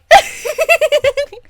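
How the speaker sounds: high loud cackle; high shrieking cackle; shrieking laugh. Real, not acted. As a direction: crack up at your own joke. Laughing at own parody.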